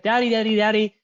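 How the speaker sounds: lightly voiced eager little girl; imitated little daughter. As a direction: call out like a kid running to dad. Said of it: "she's like".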